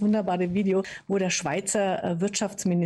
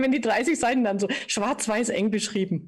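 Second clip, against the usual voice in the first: usual speaking voice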